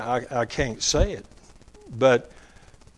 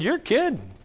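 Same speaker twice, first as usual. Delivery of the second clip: mild surprise; 10 semitones higher than usual. Retold or imitated. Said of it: "That's what I said to him".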